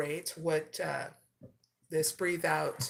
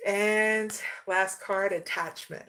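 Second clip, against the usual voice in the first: ordinary talk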